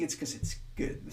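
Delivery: breathy, almost unvoiced aside; softly tossed-off conclusion; hushed punchline. Real, not acted. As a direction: drop to a near whisper for the payoff. Punchline dropped to near whisper.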